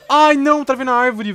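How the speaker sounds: loud, high cry of frustration; dismayed outcry. Real, not acted. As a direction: cry out as the game traps you. Reage a travar na árvore. (grito agudo de frustração)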